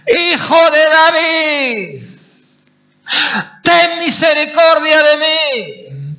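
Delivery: high, slow, wailing plea at full voice; desperate shout. Acted. Blind man begging Jesus for mercy, desperate shout. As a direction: beg at the top of your lungs. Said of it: "A gritar".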